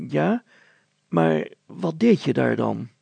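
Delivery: a mayor who can't quite believe her; doubtful mayor. Acted. Mayor questioning the girl, in disbelief.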